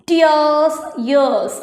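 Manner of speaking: ടിയേഴ്സ് rising, ഇയേഴ്സ് falling, each drawn out; demonstrated rhyme. Shown as a demonstration. Teacher showing pupils two words sound alike, demonstrated rhyme. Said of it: "ഏകദേശം ഒരുപോലെ പറയുന്നുണ്ട്".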